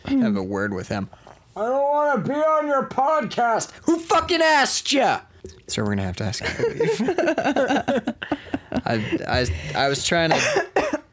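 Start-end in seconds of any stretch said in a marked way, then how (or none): 1.5-5.2 put-on old prospector. Cranky patient snapping. 10.3-11.0 put-on high slow old-timer; drawn-out creaky old man; old prospector.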